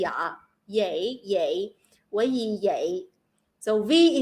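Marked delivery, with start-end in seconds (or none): 0.0-1.7 "dạ" and "yay" with y replacing v; Southern y sound. Shown as a demonstration. Showing listeners the Southern sound, y in "yay" instead of v. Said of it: "instead of say V, they say the". 2.1-3.1 Southern Vietnamese, vậy said as yay; Southern Vietnamese accent. Shown as a demonstration. Explaining the Southern sound to listeners, Southern Vietnamese accent. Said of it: "in the South of Vietnam".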